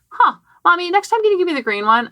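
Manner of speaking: put-on high, small child's voice asking politely; a young girl making a sweet request; little daughter. Mom voices her young daughter.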